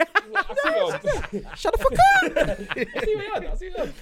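high, slow, drawn-out laughing taunt; laughing mockery. Real, not acted. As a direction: laugh the line at him, stretched out high. Mocking a friend's argument, laughing.

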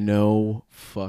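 slow, drawn-out disbelief; stunned disbelief. Retold or imitated. Telling how they reacted to the guard's demand, stunned disbelief. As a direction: stretch each word in stunned, low disbelief.

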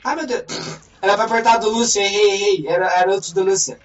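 loud, high panicked gamer outburst; loud exclaiming. Real, not acted. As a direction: blurt it out in alarm. Panicking over game mistakes.

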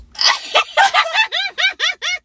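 high loud laughing burst; burst of laughter. Real, not acted. Recalling the catcall, high loud burst of laughter. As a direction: crack up hard.

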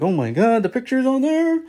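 retold panic. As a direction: squeal it in mock panic. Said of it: "I was freaking out".

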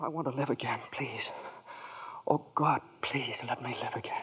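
soft, desperate whispered begging; hushed, broken prayer; quiet pleading prayer. Acted. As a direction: plead with God under your breath. Praying to be sent back.

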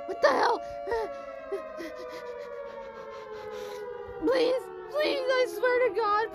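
begging for her friend's safety in high panic; panicked pleading. Acted. Naomi begs everyone to keep Nancy safe, high and frantic. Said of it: "Naomi went like".

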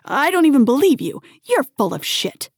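high, loud, indignant disbelief; stammered protest. A narrator's reading. Man refuses to believe her over the phone, indignant protest. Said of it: "he stammered".

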